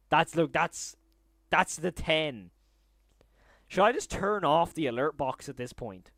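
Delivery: almost shouting in giddy disbelief; overwhelmed exclamation. Real, not acted. Streamer to chat about endless alerts, loud giddy disbelief.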